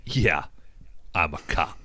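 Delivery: dry sarcastic mutter. Retold or imitated. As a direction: say it like you mean the opposite. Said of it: "like, the sarcastic".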